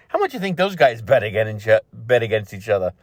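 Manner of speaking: usual speaking voice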